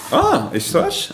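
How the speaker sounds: high, drawn-out surprised ah; genuinely taken aback. Real, not acted. Interviewer reacts to hop-farmer news.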